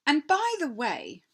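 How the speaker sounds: lightly voiced queen, slow and stately; a hint of a grand, coaxing queen. A narrator's reading. Queen pointing the way for Edmund, lightly voiced.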